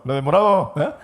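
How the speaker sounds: the same words thrown out loud; loud mock call. The speaker re-does his brother's line about a girl, loud teasing call.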